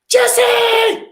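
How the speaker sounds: big happy yell to welcome Jesse; calling out. Real, not acted. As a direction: shout the name out in greeting. Greeting guest by calling out.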